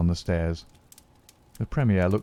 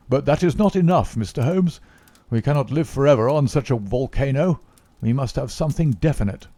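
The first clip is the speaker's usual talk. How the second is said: a lightly raised, pressing statesman; a hint of the Premier's impatience; impatient Premier; 6 semitones higher, 4 dB louder than usual. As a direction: demand results from Holmes.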